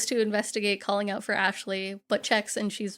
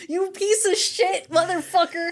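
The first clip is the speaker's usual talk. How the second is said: indignant insult; 8 semitones higher, 4 dB louder, slower than usual. Real, not acted. Angry insult at character.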